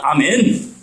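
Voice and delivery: normal talking voice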